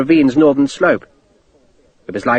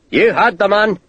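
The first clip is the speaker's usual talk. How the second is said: bellowing; 6 semitones higher than usual. A narrator's reading. Scottish sergeant to faltering men, bellow. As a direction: back up the officer's order. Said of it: "the Scottish sergeant bellowed".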